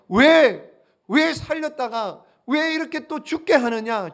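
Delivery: loud, reproachful cry of a grieving mother; resentful widow. Retold or imitated. Widow loudly reproaches the prophet Elijah, grieving. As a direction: hurl the blame at the prophet. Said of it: "이렇게 원망하는 거예요".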